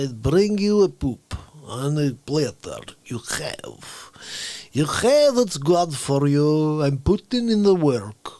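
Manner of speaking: put-on slow Russian-accented Putin; Putin impression. Putin talking to Donald in a slow Russian accent. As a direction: lean into a heavy Russian accent. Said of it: "sounds like this".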